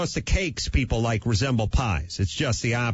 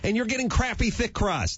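exasperated rant; 9 semitones higher than usual. Retold or imitated. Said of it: "retorting to the pizza snob".

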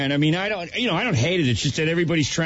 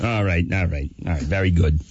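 buzzing robotic drone, lower; electrolarynx Danny; 10 semitones lower than usual. Acted. Cancer Danny responds to an unhappy Howard. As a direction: answer Howard through a mock electrolarynx. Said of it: "Cancer Danny's reads".

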